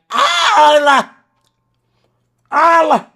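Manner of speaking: twin brother yelling his name to catch him out, high and loud; shrieking high and loud, catching someone red-handed; brother's shout. Retold or imitated. Brother bursting in shouting when he catches him. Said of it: "And he was like".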